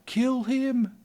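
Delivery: very high, very loud mob chant; angry crowd yelling to kill the foreigner. Retold or imitated. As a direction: scream it like an enraged crowd calling for blood. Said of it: "they start yelling".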